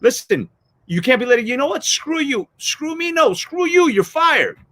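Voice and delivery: a hammed-up angry boss; boss firing you. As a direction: slam the door on him, screw you.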